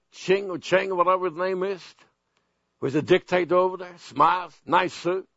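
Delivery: ordinary delivery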